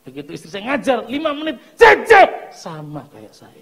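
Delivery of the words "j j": sudden loud, high-pitched shout; explosive mock yell. Wife teaching her child loses patience, explosive yell. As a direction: blow up at the child in one yell. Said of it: "Nggak kayak saya meletup-letup".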